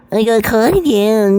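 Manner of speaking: high, drawn-out whiny wail; exaggerated sing-song blubbering; mock crying. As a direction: mimic a crybaby bawling about being caught. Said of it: "So don't go around crying".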